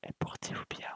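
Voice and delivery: unvoiced ASMR whisper; right in your ear. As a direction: breathe it softly into the mic. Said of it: "On finit en A S M R".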